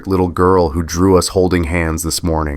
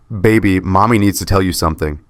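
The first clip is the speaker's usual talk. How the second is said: usual speaking voice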